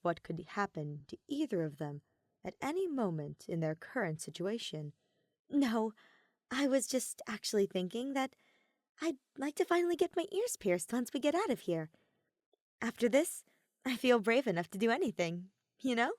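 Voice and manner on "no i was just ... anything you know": Kaede's voice. Acted.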